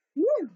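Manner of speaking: loud high sigh of relief; relieved phew. Acted. Rosie, relieved it is only a cat, sighs phew. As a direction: let the relief out. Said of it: "sighed Rosie with relief".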